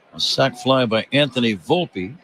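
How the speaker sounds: usual speaking voice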